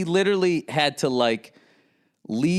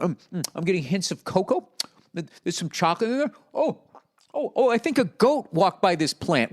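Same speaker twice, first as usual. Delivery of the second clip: higher, affected connoisseur tone; lightly mock a cigar snob; snobby cigar reviewer; 5 semitones higher than usual. Mocking a cigar reviewer describing tasting notes.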